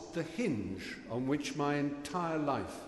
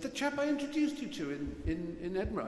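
much higher, mock posh friend explaining; Emma's voice; 7 semitones higher than usual. Emma reminds her friend who Hugh is, high mock voice. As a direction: pitch it way up like an eager friend. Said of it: "She said".

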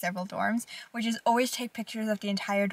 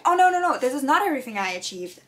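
loud, quick self-correction; excited correction; 4 semitones higher, 7 dB louder than usual. Real, not acted. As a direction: catch yourself, louder and higher. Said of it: "I belittled my accomplishments".

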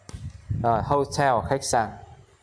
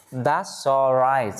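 very slow "That's all right", each word drawn out; much slower than usual. Shown as a demonstration. Teacher modelling that's all right for learners, very slow. Slow take before linked reading.

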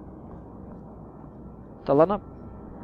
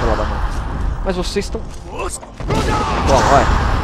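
loud grunting take that; fight cry; 5 dB louder, slower than usual. Real, not acted. Gamer striking enemies mid-fight, loud fight cry. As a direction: shout it while striking.

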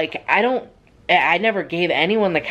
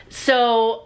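usual speaking voice

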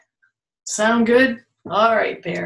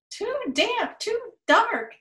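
tiny mouse squeak, much higher; squeaky mouse; 13 semitones higher than usual. Acted. Voicing the mouse for a child, high and squeaky. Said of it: "Mouse squeaks".

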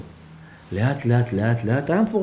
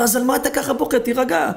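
much higher, urgent calming voice; puzzled doctor; 12 semitones higher than usual. Acted. Doctor baffled by the old man's tears, high and pressing. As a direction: press him to calm down. Doctor asks why he cries.

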